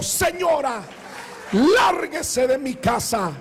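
telling her off. Retold or imitated. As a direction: throw her out of the house. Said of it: "Le digo".

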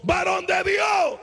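a former enemy shouting to the man of God; shouted call. Retold or imitated. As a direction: hail him across the street.

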